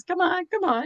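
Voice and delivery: lightly voiced high coaxing; coaxing professor. Professor urging the student to stand, light mimicry. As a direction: coax her up, playfully mimicked.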